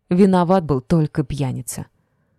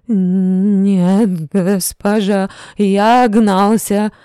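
higher, louder, slow panicked admission; frightened confession; 4 semitones higher, 7 dB louder, much slower than usual. A narrator's reading. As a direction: blurt out the confession in terror. Drunk confessing in fear.

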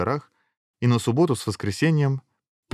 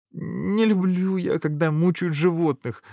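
drawn-out, higher princess voice; princess's huffy objection; 9 semitones higher, slower than usual. A narrator's reading. Princess objecting to her father, huffy and high. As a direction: start high and stretched, then shrug it off. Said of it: "дёрнула плечиком принцесса".

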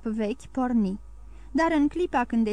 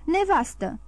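mild, higher-pitched reproach; raised-pitch warning to a wife; warning exclamation; 6 semitones higher than usual. A narrator's reading. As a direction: cut in to warn her. Husband protests at wife's murderous hint.

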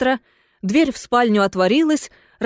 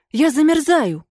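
desperate cry; 6 semitones higher than usual. A narrator's reading. Ghost lady crying to her host that she is freezing, desperate. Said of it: "запричитало привидение".

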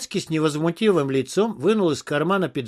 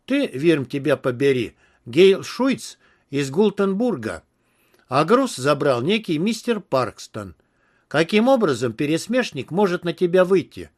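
ordinary delivery, nothing marked

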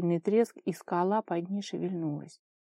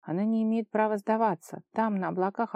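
plain reading voice

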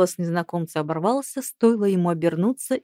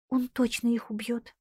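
soft, higher, scared; frightened voice; 4 semitones higher, 5 dB quieter than usual. Acted. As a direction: say it softly in fear. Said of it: "испуганным голосом произнесла Линетта". (испуганным голосом)

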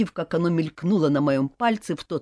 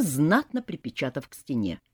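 ordinary delivery

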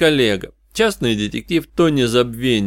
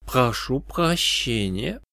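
put-on slow, soft French-accented politeness; noble French accent; 2 semitones higher, 6 dB quieter, much slower than usual. A narrator's reading. French stranger excuses himself to the detective, noble French accent. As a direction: interrupt courteously with a refined French accent. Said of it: "произнёс голос с благородным французским акцентом".

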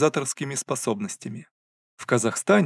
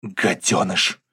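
hissing; 6 semitones lower, much slower than usual. Acted. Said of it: "шипит старшая сестра".

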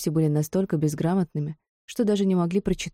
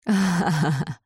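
rough mocking laugh; coarse guffaw; slower than usual. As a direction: bray a crude laugh. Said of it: "грубо заржал лысый карлик".

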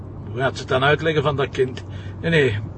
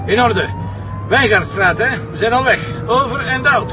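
louder, higher, urgent; urgent radio sign-off; 8 semitones higher, 3 dB louder than usual. Acted. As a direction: sign off and rush away. Commissioner signs off radio hurriedly.

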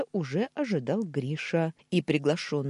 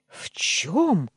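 loud, higher outraged question; indignant; 4 semitones higher, 7 dB louder than usual. A narrator's reading. Uncle told to confess, gasping with indignation. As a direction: choke with indignation. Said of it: "задохнулся он от возмущения".

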